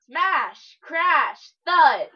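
loud, punched single words; mock crashes, each word hit hard; spoken sound effects. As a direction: boom each noise out like a comic book. Voicing crazy noises as sound effects.

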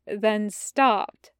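plain reading voice

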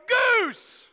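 high loud "Goose!" shouted; retold yell. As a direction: yell "Goose!" across the room like a gotcha. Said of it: "in front of everybody yelled".

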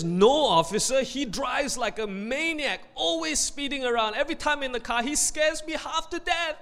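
high-pitched, rising tattletale complaint; exasperated wife. Acted. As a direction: complain about him in a high, worked-up voice. Wife contradicts husband to officer.

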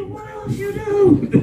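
high, drawn-out mock shout; imitating amazed questioner. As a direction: stretch it out high like an astonished listener. Retold brother's amazed question.

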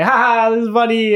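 high, loud fake ha-ha; mock amusement. Warning friends about careless ghost-calling, in mock amusement. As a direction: play the oblivious fool having fun. Acting out a naive ghost-caller.

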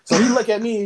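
usual speaking voice

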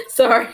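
high-pitched burst of laughter; laughing. Real, not acted. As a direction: laugh out loud, can't hold it in. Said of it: "I have to laugh".

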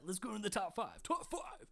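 building into a big show announcer shout; announcer hype. Acted. Host kicking off the top five, hyped announcer call.

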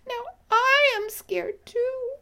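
high, loud, frightened little bee; scared bee. Acted. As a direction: squeal it like an alarmed bee. Bee acts scared in story.

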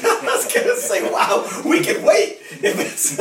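talking through a high laugh; amused, breathy laughter while speaking. Real, not acted. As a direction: let the laugh break into the words. Relieved laugh over driving misunderstanding.

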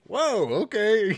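high, slow drawn-out whoa at the late hour; mock surprise. Noticing it is ten forty-five, high slow surprise. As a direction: caught off guard by the clock.

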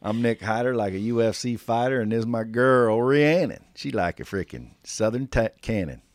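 put-on Southern accent. Host pretends to be guest introducing his wife, Southern accent. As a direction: play a Southern braggart introducing his girl. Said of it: "come out with my Southern accent".

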